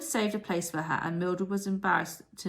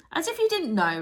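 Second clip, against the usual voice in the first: breathless and excited; 10 semitones higher, 6 dB louder than usual. A narrator's reading. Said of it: "breathless from bouncing".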